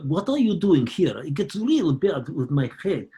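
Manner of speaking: slow, heavy mock Russian accent. Actor showing how Jodie turns Russian, slow mock Russian accent. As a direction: drag each word out like a Belarusian villain. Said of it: "when you become Russian, you go".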